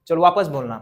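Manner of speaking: ordinary delivery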